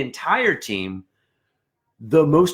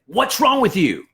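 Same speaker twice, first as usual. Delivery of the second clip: a sharp, higher, louder what's wrong with you; mock angry snap; 9 semitones higher, 4 dB louder than usual. Section leader snapping at a friend, mock angry. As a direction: bark it like a frustrated section leader. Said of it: "when you're tempted to say".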